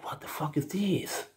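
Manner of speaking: astonished whispered aside; shocked whisper at the strange bicycle. Retold or imitated. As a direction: breathe it out in disbelief. His reaction, whispered.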